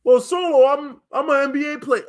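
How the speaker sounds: lightly voiced boasting guy; a hint of a proud NBA player; mock boasting man. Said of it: "but you brothers think y'all gonna do it".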